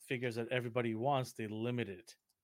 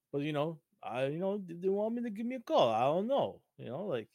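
loose impression of a hurt movie boxer; swooping, rambling tough-guy imitation; Stallone; 3 semitones higher, 3 dB louder than usual. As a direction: play the slighted star, pitch rising and falling. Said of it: "Stallone, when he, he came on".

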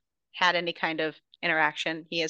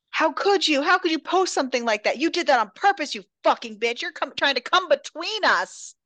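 higher, louder accusing rant; mock angry shout at sister; 7 semitones higher, 4 dB louder than usual. Sister screaming at Raven over the post, mock angry. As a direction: hurl it at the rival, hammed up. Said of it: "she whips around on Raven".

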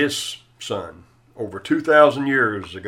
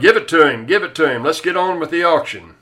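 louder, higher impatient yell; 6 semitones higher, 6 dB louder, faster than usual. Acted. Bidder yells at the auctioneer to close the sale, loud. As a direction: shout them to hurry up. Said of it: "Somebody yelled".

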